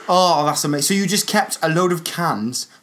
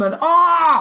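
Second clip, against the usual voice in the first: pained yelp; 13 semitones higher, 4 dB louder than usual. Pretend. Weatherman being beaten mid-report.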